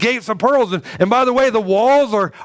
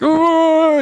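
long, high "ooh" of agony; moan of pain; 11 semitones higher, 4 dB louder than usual. Acted. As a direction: moan high as if writhing on the floor. Acting out writhing in pain.

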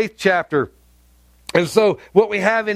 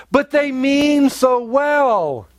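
mock excuse; 5 semitones higher, much slower than usual. Mocking excuse for wrongdoers.

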